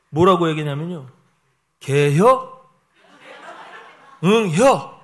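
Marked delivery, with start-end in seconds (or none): 1.8-5.0 terse, loud Chungcheong question and answer; Chungcheong dialect. Retold or imitated. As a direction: imitate two laconic Chungcheong locals, punching each tiny phrase. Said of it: "충청도 사람들".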